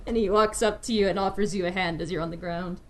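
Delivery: ordinary delivery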